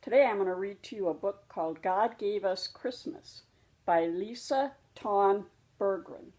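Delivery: ordinary delivery, nothing marked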